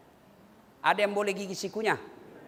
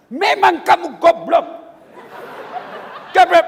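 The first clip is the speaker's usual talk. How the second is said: loud mock yell of abuse; insulting wife; 6 semitones higher, 9 dB louder than usual. Wife cursing husband as stupid, loud mock yell. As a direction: bellow the insult high and loud. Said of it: "maki-maki, menghina suami".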